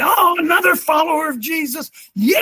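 high, shouted cheer for a fellow believer; loud mock enthusiasm. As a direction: shout it out like a thrilled testimony. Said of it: "Rather than say".

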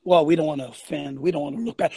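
low, mock-meek voice. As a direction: play a mealy-mouthed believer afraid to offend. Mocks timid Christians avoiding offence.

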